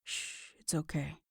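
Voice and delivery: very soft hush; calm her down in a near whisper; comforting her tears, hushed and soft; soothing murmur. Acted. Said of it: "he murmured as he held her".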